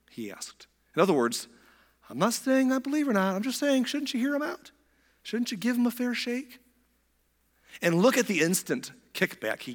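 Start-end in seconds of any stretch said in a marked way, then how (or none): 2.1-6.6 high, timid put-on voice; Nicodemus. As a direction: speak up a little, cautiously, pitched way up. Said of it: "courage to speak up a little bit".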